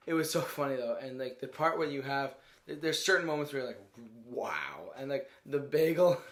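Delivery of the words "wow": low, hushed wow; imitated stunned reaction, low and soft; mock awe. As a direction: drop the pitch right down in mock amazement.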